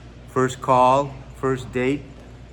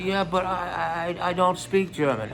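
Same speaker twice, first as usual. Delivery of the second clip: halting, higher-pitched mock embarrassment; embarrassed stammer; 5 semitones higher than usual. Man caught faking German backs down, embarrassed stammer. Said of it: "he got embarrassed".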